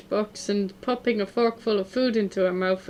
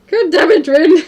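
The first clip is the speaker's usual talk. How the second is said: loud, high burst of exasperation; yelled outburst, high and loud; exasperated shout; 6 semitones higher, 12 dB louder than usual. Acted. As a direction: shout it out at a maddening friend. Acting out exasperated outburst at Rin.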